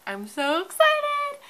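very high, slow, loud squeal; excited squeal. Real, not acted. Vlogger opening her new editor, squealing loud and high with joy. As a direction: stretch it out in a thrilled high shriek.

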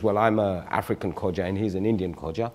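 ordinary talk

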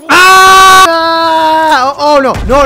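loud sudden scream of fright; startled scream. Real, not acted. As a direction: scream in shock. Sudden scare in game.

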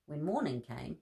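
plain reading voice

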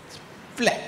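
usual speaking voice

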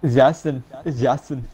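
German-style "Jason" with an open first vowel; mock German accent. Friend showing how to say Jason, exaggerated German accent. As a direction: try "Jason" as a German, saying "Yah-son". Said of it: "Jason in a German accent".

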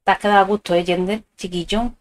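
ordinary talk, nothing marked

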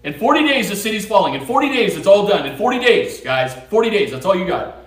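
loud, high street-preacher warning; yelling the warning at the whole city; shouted proclamation. Retold or imitated. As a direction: call it out like a doomsday prophet. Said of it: "and he's saying".

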